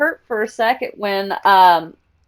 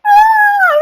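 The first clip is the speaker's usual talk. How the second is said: sudden high squeal of joy; overjoyed scream; 7 dB louder than usual. Retold or imitated. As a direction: let out a thrilled shriek. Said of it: "I start crying".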